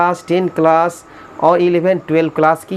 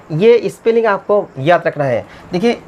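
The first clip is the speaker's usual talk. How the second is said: usual speaking voice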